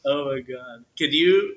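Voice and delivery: usual speaking voice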